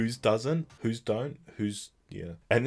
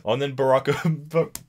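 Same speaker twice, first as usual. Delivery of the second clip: ordinary delivery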